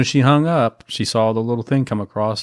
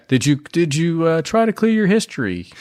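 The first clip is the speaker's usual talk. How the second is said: higher-pitched help desk imitation; mock help desk; 6 semitones higher than usual. Co-host mimics work help desk questioning him, high mock voice. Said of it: "what the help desk at my work always says".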